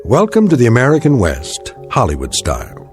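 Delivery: ordinary talk, nothing marked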